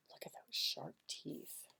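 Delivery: unvoiced, hushed dragon warning; spooky whisper. A narrator's reading. Narrator hushing the child about the scary dragon. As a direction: whisper it like a secret danger.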